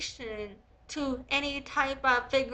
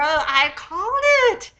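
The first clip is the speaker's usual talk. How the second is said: loud, high, drawn-out gloat; triumphant shout; 6 semitones higher, 10 dB louder than usual. Real, not acted. Proven right about the inventor, she crows loudly. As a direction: crow it slowly, savouring the win. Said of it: "I'm excited that I was right".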